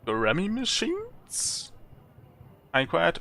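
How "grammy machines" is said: slow, high rising question; puzzled query. Acted. Traveller inquiring about the artificer's machines, puzzled query. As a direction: draw it out, climbing high in disbelief.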